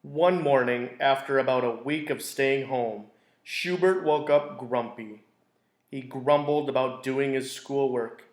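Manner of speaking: gentle storybook narration; teacher reading a picture book to a class; reading to children. A narrator's reading. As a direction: read it like circle time for kids. Reads picture book aloud.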